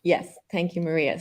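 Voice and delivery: usual speaking voice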